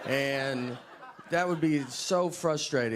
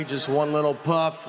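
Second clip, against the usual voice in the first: normal talking voice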